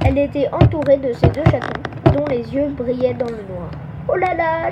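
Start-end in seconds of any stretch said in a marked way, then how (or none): 4.1-4.7 high, mildly fearful wail; frightened moan. A narrator's reading. Nina faces the menacing cat, frightened moan. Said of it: "gémit Nina ter-, terrorisée".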